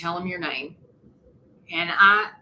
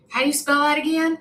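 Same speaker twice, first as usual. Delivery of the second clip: lightly voiced old man, higher; a hint of the forgetful old man asking again; mild old man voice; 8 semitones higher, 3 dB louder, faster than usual. Old man asks spelling again.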